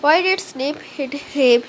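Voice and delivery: plain reading voice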